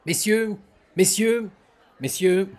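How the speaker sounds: loud stage call across a room. Acted. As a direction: hail the room, big and loud. Cyrano calling out to the tavern.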